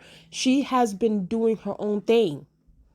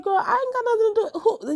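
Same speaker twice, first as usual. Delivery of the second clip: much higher, sassy reality-star meme voice; Nene Leakes meme voice; 14 semitones higher than usual. Retold or imitated. Rhaenyra denying the murder, in a high Nene Leakes meme voice. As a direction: play it like a diva shrugging off blame. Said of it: "In my Nene Leakes meme voice".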